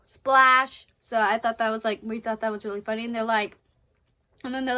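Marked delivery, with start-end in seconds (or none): 0.1-0.9 loud, comic exclamation; big, deadpan-joke splash; called-out sound word. Acted. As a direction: shout the sound effect as a word. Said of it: "he's just gonna go".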